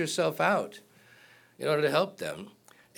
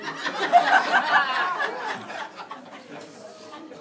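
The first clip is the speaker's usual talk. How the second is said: high breathy burst of laughter; cracking up at his own joke; real laugh after the hoes joke; big laugh. Real, not acted.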